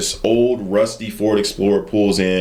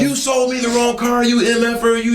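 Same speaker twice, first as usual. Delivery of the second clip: high mock furious rant; cussing out; 12 semitones higher than usual. Angry customer cussing out the salesman by phone, high mock fury. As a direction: tear into him, pitched way up. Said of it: "she is cussing me out".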